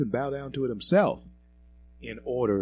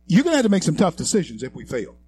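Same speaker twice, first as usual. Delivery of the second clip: fast, loud and higher warning; 8 semitones higher, 10 dB louder, much faster than usual. Real, not acted. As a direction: hammer the warning home. Warning of tough decisions.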